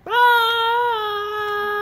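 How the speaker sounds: high, loud playful shriek; mock scream. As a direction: scream at the shark. Frightened by the shark puppet.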